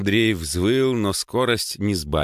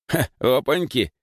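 mocking little "ha" of surprise, higher; amused snort; 6 semitones higher than usual. A narrator's reading. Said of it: "хмыкнул пижон". (насмешливо хмыкая)

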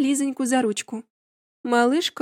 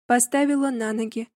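usual speaking voice

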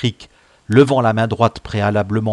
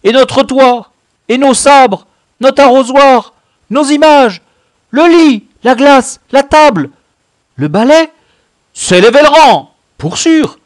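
very high, shouted outcry over losses; loud lament; 15 dB louder than usual. Acted. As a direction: cry out each loss at full voice. Boys lamenting their looted hut. (se lamenter à grands cris aigus)

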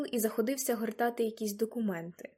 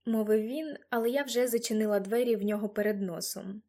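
usual speaking voice

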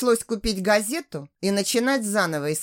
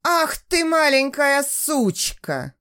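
slow, higher, louder furious yell; 6 semitones higher, 4 dB louder, slower than usual. A narrator's reading. Splashed customer to waitress, furious yell. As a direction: bellow the insult, stretched out. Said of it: "завопил он".

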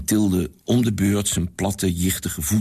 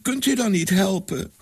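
put-on begging girl; 10 semitones higher than usual. A narrator's reading. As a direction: plead with him for help. Girl begs Sanin. (hoog smekend meisje)

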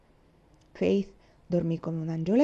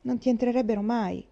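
ordinary delivery

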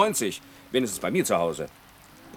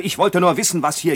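irritated; 6 semitones higher, 5 dB louder than usual. Acted. Irritated retort after "Zum Teufel.

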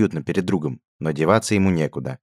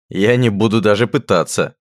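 chuckling; 4 semitones higher, 7 dB louder than usual. A narrator's reading. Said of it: "хохотнул Радгар".

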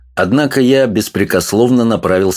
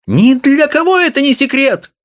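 very high outraged cry; wife's shout. A narrator's reading. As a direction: shout it back at him. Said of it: "крикнула она". (жена кричит высоким голосом)

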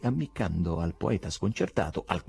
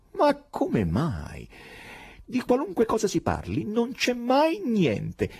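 high, odd foreign professor, amused and exasperated; eccentric professor voice; slower than usual. Acted. The professor mocks the poet, in a high strange voice.

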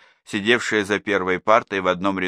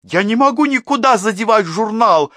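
much higher and louder indignant cry; indignant outburst; 14 semitones higher, 8 dB louder than usual. A narrator's reading. Class teacher snaps back at the major, indignant. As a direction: burst out, deeply offended. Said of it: "возмутилась классная руководительница".

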